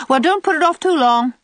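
high, loud, knowing warning; significant. A narrator's reading. Old aunt advising Diana on marriage, significantly. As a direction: load the advice with meaning. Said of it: "said Aunt Atossa significantly".

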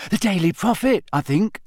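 high, eager schoolboy; Colin. A narrator's reading. Young fan answering Harry's question, high and eager. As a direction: answer brightly in a high boy's voice.

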